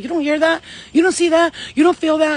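rising disbelief, each question pushed harder; urgent bewildered questions. Retold or imitated. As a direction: insist they must hear it too. Retelling her confused pleading.

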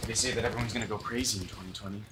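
rushed aside. Acted.

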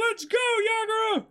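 put-on rally the fish like a kid on a ride; excited cheer. Luffy cheers his bull forward, high and loud.

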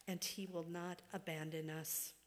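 ordinary talk, nothing marked

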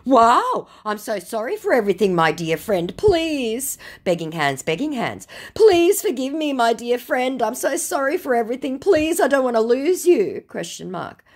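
high, pleading mock scammer. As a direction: ham up the wheedling scammer. Scammer begging, chat read out.

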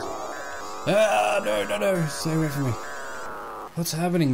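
backing away in fright; scared horror game streamer. Real, not acted. Fleeing marble worm, noises.

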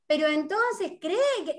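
high-pitched mock challenge; insistent follow-up question. Interviewer acting out a follow-up question, insistent. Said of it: "y si hay una repregunta".

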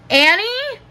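high, questioning call; alarmed call. A narrator's reading. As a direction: call the name as a worried question. Alarmed call after hearing a shriek.

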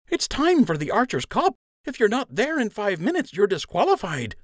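high, hurried voice of a pushy friend; urgent friend. Acted. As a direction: get him out of bed, urgent. Lund rouses Joe.

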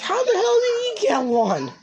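high and slow, every word pushed; real exasperation, slowed and leaned on. Real, not acted. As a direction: drag it out in jealous disbelief. Repeats jealous question.

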